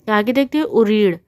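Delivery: ordinary talk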